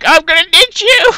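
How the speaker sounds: high, loud imitated cry; hammed-up high-pitched holler; mock yell. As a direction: yell it like a co-pilot bailing out. Voices the fleeing co-pilot in panic.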